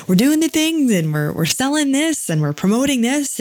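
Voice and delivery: mocking people who hide the messy part, in a peppy voice; mock upbeat promoter. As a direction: mimic the polished promo crowd cheerfully. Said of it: "They're just like".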